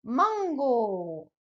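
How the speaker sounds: mango, slow and careful; Japanese mango. Shown as a demonstration. Teacher warning then modelling mango. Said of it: "Donc, attention".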